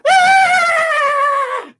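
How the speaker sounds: long, very high, loud shriek; terrified scream. Acted. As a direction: scream like a frightened little girl. Scared girl screams at the bear.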